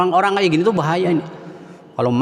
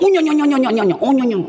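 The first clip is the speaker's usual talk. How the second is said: mock emotional outburst; 9 semitones higher, much faster than usual. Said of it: "Ada gini, wah, langsung".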